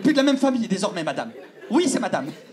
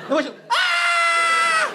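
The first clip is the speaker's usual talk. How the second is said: long high-pitched terrified scream; much slower than usual. Retold or imitated. Fleeing the possessed girl, long terrified scream. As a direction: let out a long panicked shriek. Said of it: "pendant que je crie".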